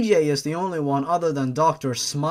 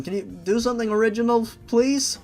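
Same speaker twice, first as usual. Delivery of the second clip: fast, rising, fed-up please; exasperated plea; 6 semitones higher than usual. Real, not acted. Reader pleading with the fanfic author, exasperated and fast. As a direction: beg the author to stop, sighing it out.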